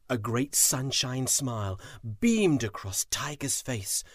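ordinary delivery, nothing marked

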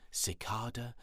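plain reading voice

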